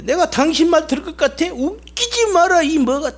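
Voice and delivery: high, mocking defiance with the stress on 말; scornful angry rant. Slave scorning the master who bought him, angry refusal. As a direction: spit the refusal at your new master, slowing on 웃기지 마라. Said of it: "막 욕을 퍼부어대는 거야".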